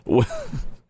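short high burst of laughter; brief helpless high giggle; amused laugh. Real, not acted. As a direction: laugh breaking out mid-reading. Reader amused by old wording.

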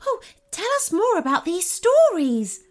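eager unicorn. A narrator's reading. First unicorn begs the boy for stories, eager.

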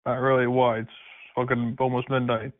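normal talking voice

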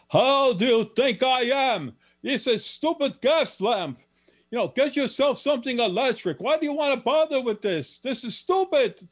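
high-pitched, exasperated Polish shopkeeper; Polish accent. Retold or imitated. Polish shopkeeper dismissing a customer's stupid gas lamp, high exasperated Polish accent. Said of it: "the man is Polish".

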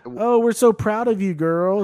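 high, mock-proud gushing; imitated cheerleading friends. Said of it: "they're like".

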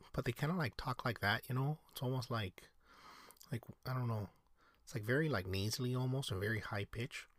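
a light Minnesotan accent demo. Shown as a demonstration. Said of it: "how good is my, uh, Minnesotan accent".

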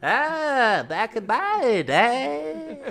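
put-on very high, very slow old-timer; old man voice. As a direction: drag it out like a creaky grandpa. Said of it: "better old man voice".

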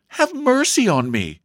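high-pitched panicky plea; gasped plea. A narrator's reading. Panic-stricken sister begs her brother, gasping. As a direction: beg breathlessly for release. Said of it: "she gasped".

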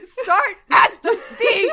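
loud, slow, laughing exclamation. Real, not acted. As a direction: blurt it out through laughter. Listener echoes line, laughing.

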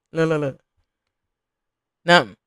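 low, muttered look look look; quiet low mutter at the screen. Real, not acted. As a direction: mumble it under your breath. Urging dancer on screen, low.

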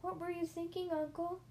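high, sweet little voice; high-pitched, innocent question; sweetly. A narrator's reading. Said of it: "my nephew Benjamin asked sweetly".

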